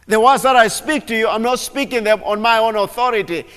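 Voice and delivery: very loud, preaching at full force; fervent preaching. Acted. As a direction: proclaim with rising fire. Preacher voicing Jesus at full force.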